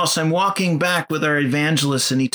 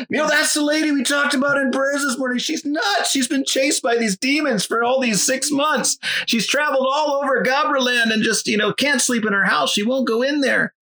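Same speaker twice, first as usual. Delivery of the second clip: higher, anxious retelling; nervous evangelist; 7 semitones higher than usual. Retold or imitated. Evangelist warning about the lady, higher and anxious. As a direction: voice the shaken evangelist.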